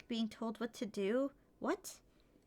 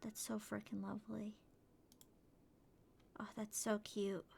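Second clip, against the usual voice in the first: hushed; 10 dB quieter than usual. Real, not acted. Admiring outfit.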